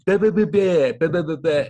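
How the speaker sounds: high, loud babbling broadcast chatter; mock TV patter. Guest mimics his post-game show, loud mock patter. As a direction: rattle off nonsense like an on-air analyst.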